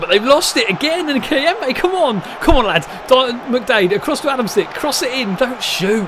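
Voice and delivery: excited urging. Real, not acted. Urging his players on during an attack, excited. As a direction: yell at the screen for the lads.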